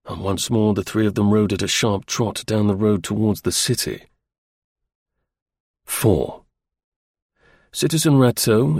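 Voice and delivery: ordinary delivery, nothing marked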